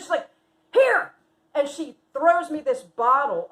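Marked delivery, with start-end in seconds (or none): 0.7-1.1 loud, high one-word order; abrupt command. Retold or imitated. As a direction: imitate a friend shoving something at you. Friend tosses her a bottle.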